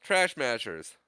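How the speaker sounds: high, loud urgent order; mock yelling. Trapped heroes yelling to the droid, high mock-urgent shout. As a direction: yell it like the walls are closing in.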